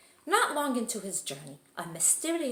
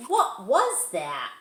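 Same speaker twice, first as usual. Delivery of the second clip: startled question; 7 dB louder, slower than usual. Acted. Startled kitten at mirror.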